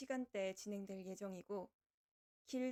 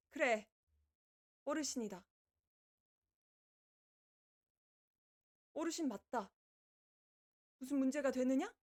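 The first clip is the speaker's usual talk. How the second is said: mock defiant; 3 semitones higher, 6 dB louder than usual. Answering chat calling him elder.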